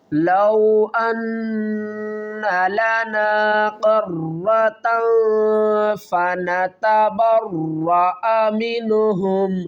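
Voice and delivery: slow, measured tajweed recitation; Quran recitation. Shown as a demonstration. Teacher reciting the verse slowly for students. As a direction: chant each word with care for the students.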